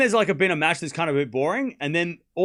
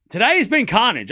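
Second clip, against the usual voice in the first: emphatic declaration; 8 semitones higher, 8 dB louder than usual. Real, not acted. Emphatic repeated catchphrase.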